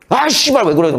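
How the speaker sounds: sudden jump up to an irritated swear; mock explosive outburst. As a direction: pretend to finally snap after holding back. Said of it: "막 이런다고".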